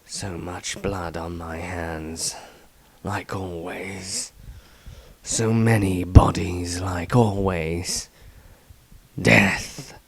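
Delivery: low, slow voice, whispered in places; a weary killer writing by candlelight; grim brooding diarist. Acted. Brooding diary entry, unnamed writer.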